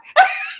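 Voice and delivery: a sudden high laugh; loud high laughing burst; high burst of laughter. Real, not acted. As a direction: crack up at the memory.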